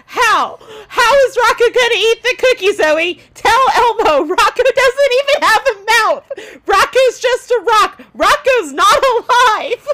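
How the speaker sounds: high, loud hammed-up meltdown; comic outraged ranting; Elmo losing his mind. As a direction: shout in exasperated disbelief, building on each protest. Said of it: "And Elmo loses his mind".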